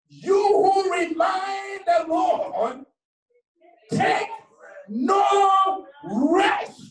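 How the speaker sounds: high, drawn-out preacher's shout; fervent scripture cry. Real, not acted. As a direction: build to a soaring, stretched cry. Declaims scripture at full force.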